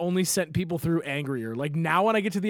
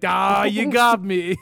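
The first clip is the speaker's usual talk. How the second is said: mock caught scammer; 2 semitones higher, 5 dB louder, much slower than usual. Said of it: "they're just gonna be like".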